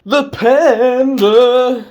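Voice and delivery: high, loud, very drawn-out cheer; excited announcement. Pretend. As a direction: unveil it like a game show host. Proudly presents mic brand.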